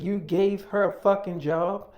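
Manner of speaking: retelling her outraged question, loud and slow; angry disbelief. Retold or imitated. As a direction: spit out the outrage at him. Girlfriend angry about sister's job.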